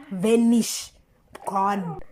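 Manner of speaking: ordinary talk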